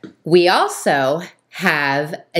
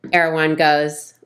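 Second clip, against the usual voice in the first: normal talking voice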